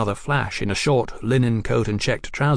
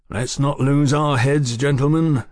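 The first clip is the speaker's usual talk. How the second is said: warning; 4 semitones higher, 4 dB louder than usual. A narrator's reading. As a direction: caution excited companions deliberately. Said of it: "Fraser warned".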